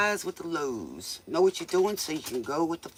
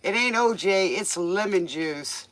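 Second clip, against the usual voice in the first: ordinary talk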